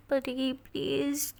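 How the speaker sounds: slow, hushed, anguished pleading; pleading softly. A narrator's reading. Ishani alone, anguished at the thought of Pari crying. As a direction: beg her not to cry, almost breathless.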